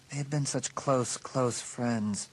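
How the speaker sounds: ordinary delivery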